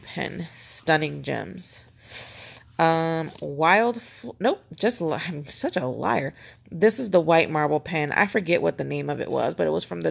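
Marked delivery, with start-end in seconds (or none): none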